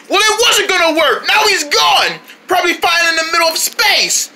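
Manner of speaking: high, exasperated outburst; exasperated scolding. Acted. Scolds after failed rescue.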